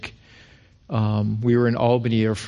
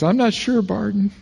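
a light quote of the wife, higher; wife's voice; 8 semitones higher than usual. Retold or imitated. Husband retelling wife's reply, lightly voiced. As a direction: mimic his wife's hesitant answer. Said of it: "She goes".

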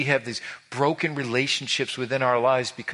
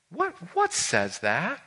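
God probing; 6 semitones higher, slower than usual. Acted. God questioning the proud heart.

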